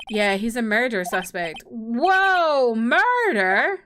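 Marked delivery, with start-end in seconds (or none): 1.6-3.8 big drawn-out swoops of disbelief; shocked. Acted. Friend learning his classmate is a murder suspect, shocked. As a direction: exclaim it with mock astonishment, rising at the end.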